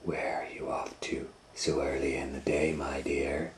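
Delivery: whispered, sly wolf voice. Acted. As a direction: lure her in with a hushed purr. Sly wolf greets girl.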